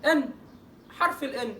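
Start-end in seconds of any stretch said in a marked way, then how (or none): 0.0-0.3 "ان" as a short "en"; letter N sound. Shown as a demonstration. Teacher showing the ending of mountain, plain N sound. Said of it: "بينطق ازاي؟".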